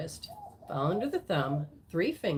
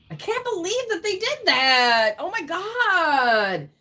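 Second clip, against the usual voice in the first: high, loud outraged disbelief; shocked disbelief; 10 semitones higher, 8 dB louder, slower than usual. Retold or imitated. Reader voicing the viewer's hurt, in loud high disbelief.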